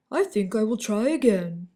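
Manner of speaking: lightly voiced hopeful lion. A narrator's reading. Dandelion speaks in story.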